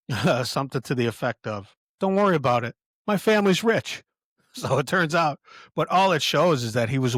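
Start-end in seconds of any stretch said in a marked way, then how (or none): 2.0-4.0 high, loud, carefree reassurance; breezy heiress. Retold or imitated. Narrator voicing the heiress reassuring her lover, high and breezy. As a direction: toss it off like money solves everything.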